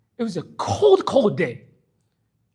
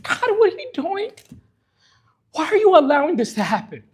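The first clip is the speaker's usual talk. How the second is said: anguished cry; 7 semitones higher than usual. Retold or imitated. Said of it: "he cried".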